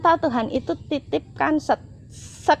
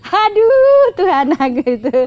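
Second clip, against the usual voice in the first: dismayed aduh; 6 semitones higher, 7 dB louder than usual. Real, not acted. Reacting to God's question again.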